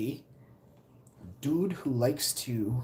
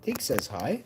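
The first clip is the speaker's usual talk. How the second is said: usual speaking voice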